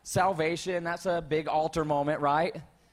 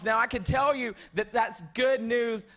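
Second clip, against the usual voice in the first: ordinary delivery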